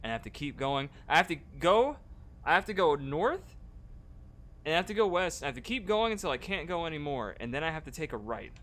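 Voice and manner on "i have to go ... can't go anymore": excited. Real, not acted. Directions building up.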